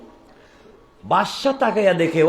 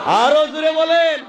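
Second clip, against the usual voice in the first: high, rising demand for more; rallying the crowd; 12 semitones higher, 4 dB louder, slower than usual. Real, not acted. Preacher urging congregation to say it louder, rallying shout. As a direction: call out high to rouse them.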